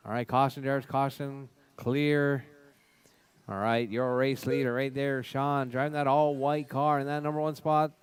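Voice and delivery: ordinary delivery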